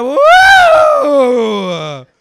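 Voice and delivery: huge mock cheer, held long; crowd whoop. Comedian mimicking the crowd cheering finance, loud mock whoop. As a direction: let out a very loud imitation whoop. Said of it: "And you're like".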